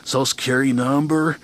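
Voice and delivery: lazy mock surfer asking; California dude. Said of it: "if I had walked in there and said".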